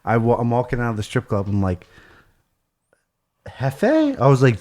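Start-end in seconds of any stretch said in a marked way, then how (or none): 3.5-4.2 high, startled call of a name; surprised. Retold or imitated. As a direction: recognize him with a jolt. Surprised to see friend outside.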